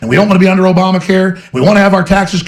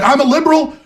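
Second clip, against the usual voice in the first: high sneering voice on "I'm a liberal", stress on "liberal"; mocking Zuckerberg; 9 semitones higher than usual. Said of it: "He's like".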